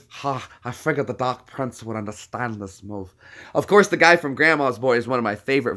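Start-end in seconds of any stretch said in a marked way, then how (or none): 0.1-3.1 softer, lopsided Little Nicky voice; Little Nicky impression. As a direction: mumble it like the devil's awkward son. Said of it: "Little Nicky".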